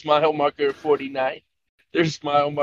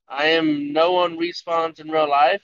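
ordinary talk

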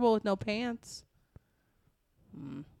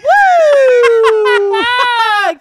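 high, loud cheering laugh; loud whooping laugh; 17 dB louder than usual. Real, not acted.

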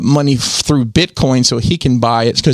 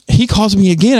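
ordinary delivery

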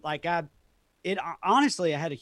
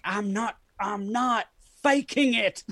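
higher, drawn-out exasperated retort; exasperated protest; 7 semitones higher, 5 dB louder, slower than usual. Retold or imitated. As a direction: protest it loudly, stretching the last words. Protesting fans' accusation of faking.

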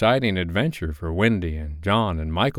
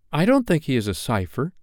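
put-on higher-pitched loyal little boy; boy's prompt cry; 6 semitones higher than usual. A narrator's reading. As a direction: jump in as a boy protesting. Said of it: "Toodles cried instantly".

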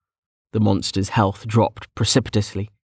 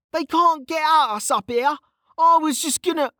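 high-pitched self-defence, cut off; insistent protest. Acted. Mage excusing himself before the rival interrupts, insistent. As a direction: keep pleading high until cut short. Kettle argues until cut off.

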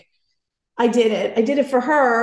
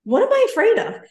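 self pep talk; 11 semitones higher, 4 dB louder, faster than usual. Retold or imitated. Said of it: "And I'm like".

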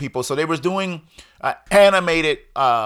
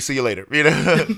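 usual speaking voice

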